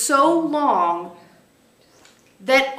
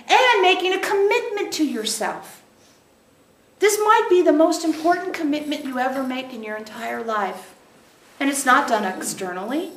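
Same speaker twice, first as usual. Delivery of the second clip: ordinary delivery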